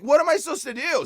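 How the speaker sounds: high-pitched acted-out plea; re-enacted helpless question; retold helpless plea. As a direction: appeal upward, at a loss what to do. Retold pleading with God.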